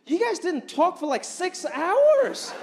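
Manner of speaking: high, slow confused question; confused girlfriend. Retold or imitated. Girlfriend confused that the guys didn't talk, asking. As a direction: drag out the disbelief. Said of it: "She was so confused".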